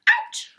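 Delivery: sudden high mock ouch; high yelp. Wolf yelping at thought of a pin. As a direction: yelp as if pricked.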